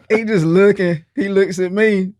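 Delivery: usual speaking voice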